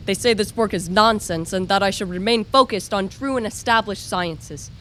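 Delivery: ordinary talk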